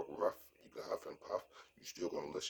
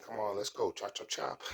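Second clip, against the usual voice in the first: higher-pitched impatient rushing; mildly rude hurrying; 6 semitones higher than usual. Retold or imitated. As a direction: hurry someone along, a little rudely. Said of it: "Even though it's a little rude".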